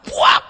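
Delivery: sharp shouted smack noise; punch sound. Retold or imitated. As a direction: spit out the sound of a slap. Imitating the father's punch. (bunyi pukulan diteriakkan)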